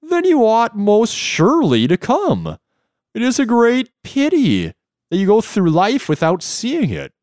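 high, wavering jellyfish voice; wobbly floating jellyfish. Acted. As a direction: gush it out in a high warble. Jellyfish persuading in story.

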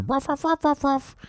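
high squeaky yips; mock barking. Imitating the barking dogs.

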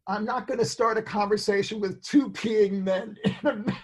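ordinary delivery

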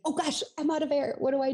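high, mock-panicked singer out of air. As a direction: squeak it out like a singer out of breath. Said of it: "you think".

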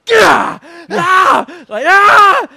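high, loud barbaric yells while stabbing the canvas; loud mock battle grunts; aggressive yells. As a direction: let out sharp war cries with each jab. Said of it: "Stabbing at the canvas".

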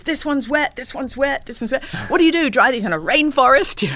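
high, loud complaining voice; fussy Vader. Retold or imitated. Vader complaining to the canteen server about wet trays, high and loud. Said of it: "he picks up the tray and he goes".

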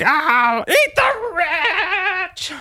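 very high, slow, drawn-out mocking wail; hammed-up looting protester; mock protester cry. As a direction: stretch the slogan out like a silly rallying cry. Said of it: "I'll be like".

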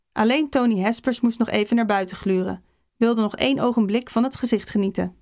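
ordinary delivery

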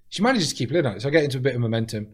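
normal talking voice

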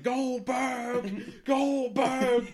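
high, drawn-out cartoonish cry; mock calling out. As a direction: imitate a wrestler wailing a rival's name. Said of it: "he's going".

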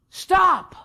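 high, loud sharp order to stop; acted scolding. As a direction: cut someone off as an annoyed crowd would. Crowd silencing the beggar.

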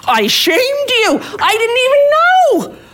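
very high, slow, stretched incredulity; squeaky slow what-are-you-talking-about; baffled disbelief. Retold or imitated. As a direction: drag it out in shocked amazement. Retold baffled reply.

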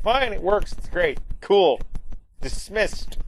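mock Skinner. Principal brushing off Lisa, in a high mock voice. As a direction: rattle it off high, wanting it over.

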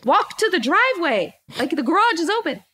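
high, incredulous mock rant; retold going off. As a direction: act out telling off a husband. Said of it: "I'm going off on him".

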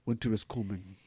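attempted northern accent on "Winter is coming". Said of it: "I'm working on my northern accent".

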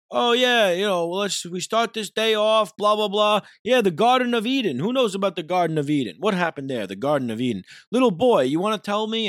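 high, loud, preachy sing-song priest; mocking priest. Mocking the priest at the baptism, high preachy voice. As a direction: parody the priest working the crowd. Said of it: "is saying".

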